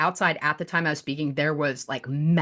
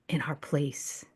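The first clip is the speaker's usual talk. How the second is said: whisper; 4 semitones lower, 10 dB quieter, much slower than usual. Acted. Whispered line in poem.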